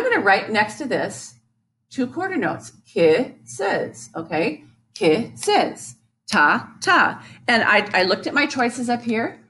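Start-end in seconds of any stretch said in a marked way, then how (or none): 2.9-4.1 "kisses" in two spaced beats; deliberate, counted-out "kisses"; two separate parts. Shown as a demonstration. Syllables sounded out. 4.9-7.2 "Kisses" and "ta, ta" as two even beats; spaced syllables on a steady beat; matching the rhythm. Shown as a demonstration.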